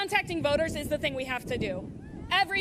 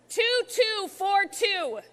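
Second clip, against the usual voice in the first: slow dictation; 3 semitones higher, much slower than usual. Real, not acted. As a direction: spell out the digits for the crowd. Dictating number slowly.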